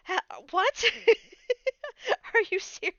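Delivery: high, loud, laughing disbelief; excited disbelief. Retold or imitated. Typing to the streamer in chat, squealing disbelief. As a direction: squeal it in happy shock. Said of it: "I'm whispering to him in chat".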